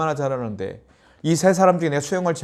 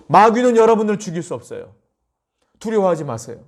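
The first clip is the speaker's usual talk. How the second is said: ordinary talk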